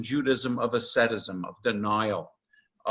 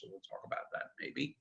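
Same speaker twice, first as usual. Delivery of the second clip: an unvoiced whisper, much softer, tossed off as an aside; 15 dB quieter than usual. Real, not acted. Lecturer whispering a side remark to the class, hushed. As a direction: drop to a quick whisper, half to yourself.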